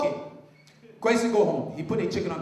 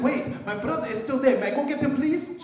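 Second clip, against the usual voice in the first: eager high plea; 6 semitones higher, 3 dB louder than usual. Acted. Young eagle pleads.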